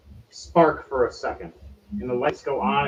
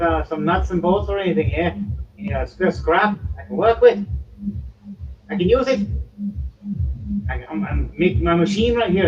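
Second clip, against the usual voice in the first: higher, louder little gnome voice; gnomish tinkerer; 7 semitones higher, 3 dB louder than usual. Acted. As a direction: pipe up as an eager small gnome scrounging for parts. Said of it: "small little gnomish man".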